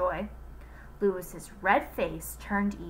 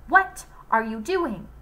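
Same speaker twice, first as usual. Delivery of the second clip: slower, louder demand; 3 semitones higher, 5 dB louder than usual. Acted. Joy confronting Louis at the door, demanding. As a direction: insist on an answer. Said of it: "Joy demanded".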